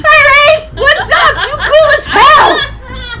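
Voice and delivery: high, loud, laughing; excited greeting. Acted. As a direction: shout hello with glee. Greeting monster Reese.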